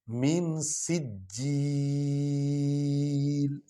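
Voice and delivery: سجیل drawn out, the ج doubled and pressed; stressed jim. Shown as a demonstration. Teacher stresses the doubled ج. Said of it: "جیم پر شد ہے".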